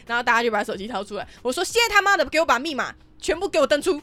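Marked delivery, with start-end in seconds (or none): 1.7-4.0 angry commands. Retold or imitated. Furious orders to coworkers.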